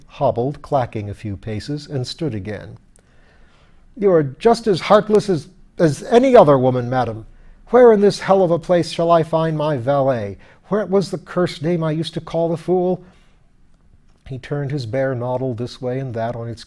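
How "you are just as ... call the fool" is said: higher, louder, aggrieved; indignant lord. A narrator's reading. Lord rebukes his heartless wife, indignant. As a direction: hurl it at her like a wounded grandee.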